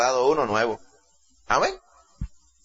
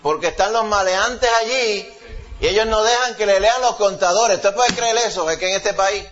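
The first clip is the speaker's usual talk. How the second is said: raised, higher preaching voice building in heat; fervent preaching; 5 semitones higher than usual. Acted. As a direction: preach it with rising indignation. Preacher rising in fervor. (predicación fervorosa, cada vez más alta)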